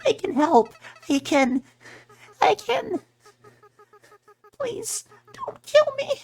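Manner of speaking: very high, pleading little flower voice; begging Flowey. Acted. Flowey pleads with the player not to kill him. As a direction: squeak out the begging, rising in desperation.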